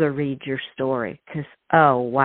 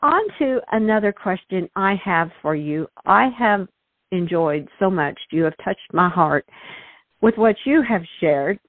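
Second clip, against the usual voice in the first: ordinary talk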